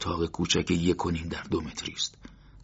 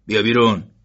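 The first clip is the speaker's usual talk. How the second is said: loud shouted command; gruff order; 4 semitones higher, 11 dB louder than usual. A narrator's reading. Guard ordering Morteza out of the cell, loud. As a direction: call him out sharply.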